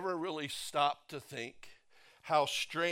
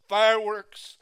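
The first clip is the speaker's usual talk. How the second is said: ordinary talk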